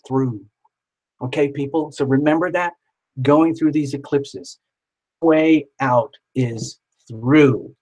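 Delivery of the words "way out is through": slowly, leaning on each word; slow emphatic. Acted. Reminding viewers of the saying, slow and emphatic. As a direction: spell the motto out slowly.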